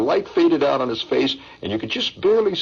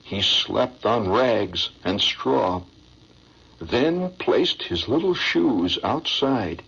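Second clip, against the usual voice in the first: low, slow, sentimental recital; poem recitation; 5 semitones lower, slower than usual. Acted. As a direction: recite it like a heart-tugging stage ballad.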